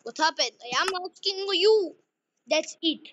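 loud, high pretend scolding ending in firing; angry boss. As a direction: fire the worker in mock fury. Manager fires the employee.

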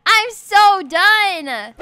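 "I'm so done" stretched very slowly; drawn-out exasperation. Real, not acted. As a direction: drag out each word of "I'm so done".

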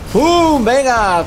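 sudden high excited shout; grenade warning yell. Real, not acted. Tossing fire grenade in combat.

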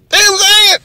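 very high, loud squeak; squeaky appeal. Real, not acted. Appealing to the audience for agreement, high squeak. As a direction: squeal it up at the crowd.

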